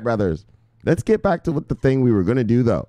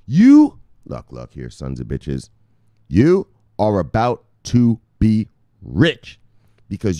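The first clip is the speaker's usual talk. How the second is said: a hint of a fired-up street preacher; 4 semitones lower than usual. Rallying his brothers to hold, like a preacher. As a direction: preach hard about getting rich. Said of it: "That brought out the preacher in a motherfucker".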